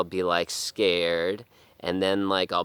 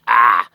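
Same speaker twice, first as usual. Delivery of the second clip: a short loud angry "Ah!" blast; angry yell; 4 semitones higher, 13 dB louder than usual. Retold or imitated. Sister flaring up at the family, loud angry yell. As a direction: bark out the sister's rage.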